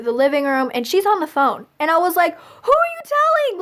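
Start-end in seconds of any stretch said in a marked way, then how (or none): 2.4-3.6 very high-pitched mock outrage; hammed-up panicked question, pitched way up; retold alarm. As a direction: squeal it in horrified disbelief. Retold alarm at mom phoning someone.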